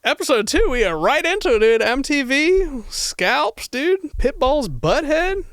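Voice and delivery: high, loud hyped host voice; hyped show intro. Acted. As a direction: kick off the episode all pumped. Jokey show intro, pitched high.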